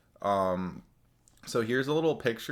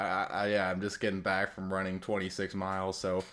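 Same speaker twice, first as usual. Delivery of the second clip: ordinary delivery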